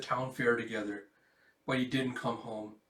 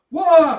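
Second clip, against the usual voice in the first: scream; 20 dB louder than usual. Acted. Explosion hits.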